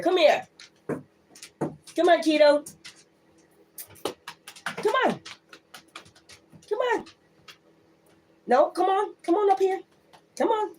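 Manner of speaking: calling a pet. Real, not acted. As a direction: coax the pet over in a high, cooing voice.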